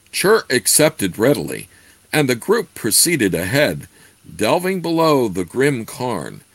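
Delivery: steady read-aloud narration; story narration. A narrator's reading. Reads the novel aloud.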